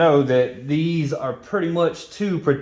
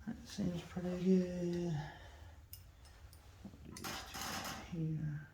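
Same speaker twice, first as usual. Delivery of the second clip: very soft, higher-pitched murmur to himself; whispering to himself; 5 semitones higher, 14 dB quieter, slower than usual. Real, not acted. Mechanic working alone on the bike, whispering to himself. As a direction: talk under your breath while working. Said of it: "I'm sorry if I'm whispering".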